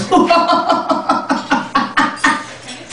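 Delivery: high, drawn-out mock cackle; creepy toothless man's laugh. Creepy man looking right at her, long high cackle. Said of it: "this creepy toothless man came out of nowhere".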